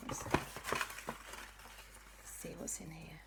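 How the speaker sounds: hushed whisper into the bag; soft unvoiced peek inside. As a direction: breathe it like a secret.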